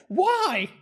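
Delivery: high, disbelieving little why; incredulous squeak. Retold or imitated. Reacting to viral TikTok guy.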